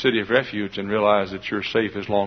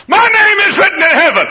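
high, loud burst of joy; ecstatic cry; 11 dB louder than usual. Acted. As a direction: shout the discovery of salvation.

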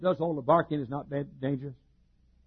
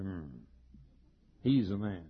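quiet confident guy; 10 semitones lower, 7 dB quieter than usual. Retold or imitated. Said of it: "looks up at you and says".

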